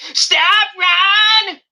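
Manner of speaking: very high, loud imitation screech; Sammy Sweetheart yell. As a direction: mimic a fed-up girlfriend screaming at her boyfriend, drawn out. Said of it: "just to yell at Ron".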